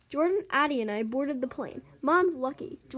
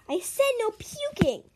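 high-pitched mock yell; 9 semitones higher than usual. A narrator's reading. Jordan to a friend about to barf, mock yell. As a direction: scold in a raised, high voice. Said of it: "Jordan yelled".

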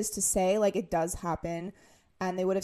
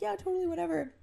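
high, breezy brush-off; dismissive salesman; 10 semitones higher, faster than usual. Retold or imitated. Salesman humoring the dad with a high breezy brush-off. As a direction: toss it off like you don't care. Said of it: "the guy that was selling me the car was like".

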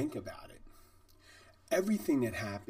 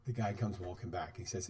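usual speaking voice

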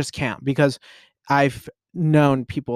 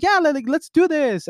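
pumped-up, much higher voice; high energy; 14 semitones higher, 4 dB louder than usual. Retold or imitated. Young girl hyping up tired adults at kickboxing, very high and pumped. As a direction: hype yourself up for the workout. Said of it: "very high energy".

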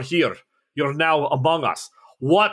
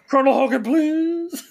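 put-on much higher, whiny German-accented plea; pleading Schultz; 13 semitones higher than usual. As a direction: beg Hogan like a bumbling guard. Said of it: "Sergeant Schultz".

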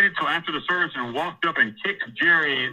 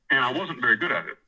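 usual speaking voice

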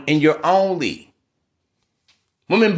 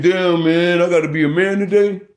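mock whining man; 3 semitones higher than usual. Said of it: "You don't hear me saying".

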